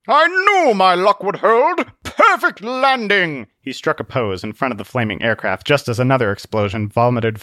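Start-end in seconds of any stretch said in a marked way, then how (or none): 0.1-3.5 high, loud, drawn-out crowing; triumphant boast. Acted. Zealot admires his crash landing, triumphant boast. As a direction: crow it slowly and grandly.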